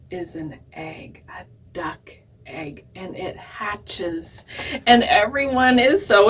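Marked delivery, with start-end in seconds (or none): 0.1-4.5 hushed storytelling. Acted. Suspense before the hatching.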